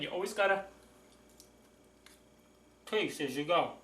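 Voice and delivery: talking while chewing; words round a bite of food; mouth full. Real, not acted. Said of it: "I will talk with my mouth full".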